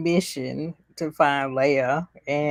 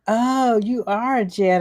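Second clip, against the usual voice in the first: higher, mock awe with stressed "are"; amazed realization; 5 semitones higher, 5 dB louder than usual. Leia after being floated safely down, mock amazement. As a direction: imitate a kid suddenly convinced, pitched up.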